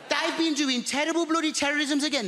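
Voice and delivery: a hint of a Birmingham neighbor saying "isis"; Birmingham accent. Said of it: "That's how she spells it".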